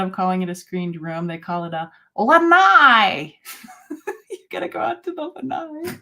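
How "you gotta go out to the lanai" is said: put-on high, fast Lowell Massachusetts accent; mother-in-law's Lowell accent. Imitating her Lowell accent, husband's mom calling outside. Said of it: "the way she says it is".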